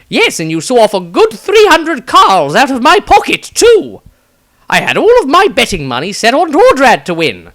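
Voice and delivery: high, loud reedy wizard voice; high loud wizard grumbling about lost bets; Maestro's voice. Acted. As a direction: play the fussy wizard complaining. Maestro character voice.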